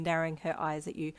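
plain reading voice

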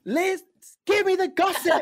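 high, loud playful demand; mock demanding shout. Interviewer pressing guest for gossip, mock demanding shout.

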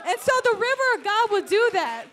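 high, very loud, building preacher's shout; full-force preaching. Real, not acted. Preacher sends the congregation out to preach the gospel, full-force preaching. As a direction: exhort the congregation at the top of your voice.

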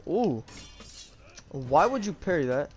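usual speaking voice

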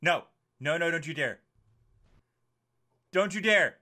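loud warning at the game; alarmed protest. Real, not acted. Player pleading with the game characters, loud protest. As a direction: protest sharply and loudly.

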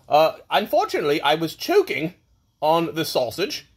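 hammed-up British lord with wildly swooping pitch; Lord Pillow Bottom. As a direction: play a pompous, silly aristocrat telling a birthday anecdote.

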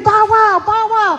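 high, loud "bow wow" dog; dog barking. Acted. Hoosie the dog running in front, barking bow wow. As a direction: bark the words out like an eager dog. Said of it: "And Hoosie's running".

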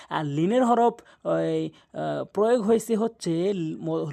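usual speaking voice

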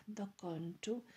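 ordinary delivery

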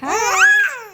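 high, loud squealed hi; demonstrated greeting. Shown as a demonstration. Mother shows baby how to say hi, high squeal. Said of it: "ಹಿಂಗೆ, ಹಿಂಗೆ ಹೇಳ್ಬೇಕಾ?".

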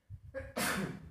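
a sudden real sneeze; sneezing. Real, not acted. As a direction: sneeze mid-sentence. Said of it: "sorry बीच में छींक आ गया".

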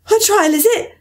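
high breathless question while running; Alice running, panting her question. A narrator's reading. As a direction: ask it out of breath mid-run. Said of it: "Alice panted as she ran".

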